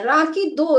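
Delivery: ordinary talk, nothing marked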